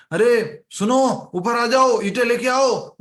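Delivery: loud, high call from above, slow start. Retold or imitated. Engineer calling down from the tenth floor, loud call. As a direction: shout up to the worker below. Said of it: "engineer ऊपर से आवाज लगाता है".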